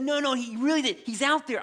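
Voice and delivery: high, eager insisting; insistent. Retold or imitated. Rhoda insisting to the doubters, high and eager. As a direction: plead that it's true.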